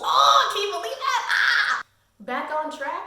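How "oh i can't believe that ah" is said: a much higher, louder squeal of joy; can't contain the excitement; thrilled squeal. Real, not acted. Thrilled about owning the books.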